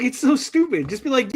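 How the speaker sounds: ordinary talk, nothing marked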